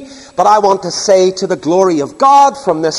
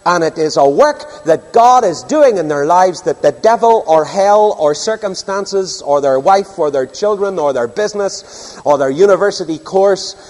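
ordinary talk, nothing marked

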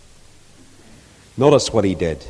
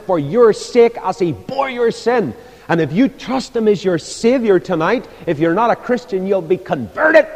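higher, louder gospel appeal building to a peak; impassioned preaching; 8 semitones higher, 5 dB louder than usual. Real, not acted. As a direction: preach it urgently at full force. Preaches at full force.

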